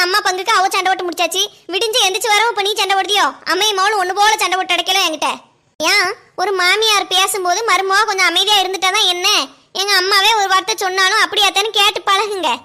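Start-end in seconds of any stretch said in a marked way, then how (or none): none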